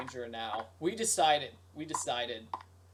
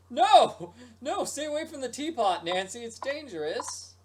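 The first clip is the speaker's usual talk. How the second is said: mock alarm; 7 semitones higher, 6 dB louder than usual. Mock warning Nancy about teapots.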